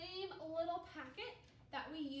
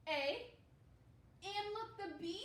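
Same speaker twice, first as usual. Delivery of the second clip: ordinary delivery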